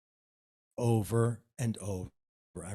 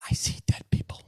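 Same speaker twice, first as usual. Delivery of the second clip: breathy movie-line whisper, voiceless; spooky whisper; 5 dB louder, faster than usual. Pretend. To his date over dinner, a spooky whisper. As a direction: whisper it like a creepy film confession. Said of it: "Not exactly the way it went".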